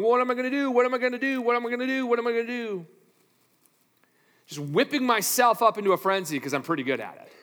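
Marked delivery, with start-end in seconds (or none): 0.0-2.9 higher, fretful repeated worrying; panicked self-talk. Retold or imitated. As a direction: spin yourself up in a mock panic. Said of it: "spinning around going".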